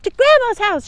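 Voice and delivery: high, loud galloping-off shout. Acted. Girl racing off on her pony, high loud shout. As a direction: call it out as she rides away. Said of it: "she shouted".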